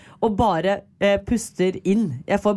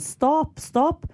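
high mock call to stop; waving arms, crying stop; retold shout; 9 semitones higher than usual. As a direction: call out stop, pitched well up. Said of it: "si sånn".

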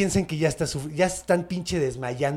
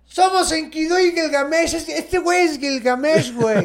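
high, loud put-on voice of a swaggering brawler, laughing through it; cocky Enkidu voice; 11 semitones higher, 5 dB louder than usual. Enkidu taunting the forest monster, high loud mocking brag. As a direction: brag and taunt like a cartoon tough guy. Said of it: "Y pinche Enkidu".

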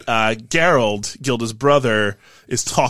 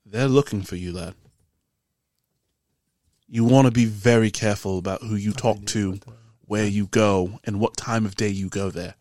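low, measured character voice with dropped r's; serious tavern keeper; 3 semitones lower than usual. Acted. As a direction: recount a threat as a grave warning. Game master voices tavern keeper's warning.

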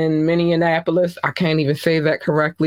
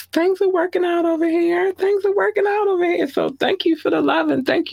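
high excited; 13 semitones higher than usual. Real, not acted. Excited about reaching downloads.

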